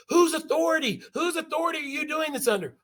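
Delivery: lightly hammed-up outraged officer; indignant commander. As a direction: sputter the question in outrage. Startled fort commander demands.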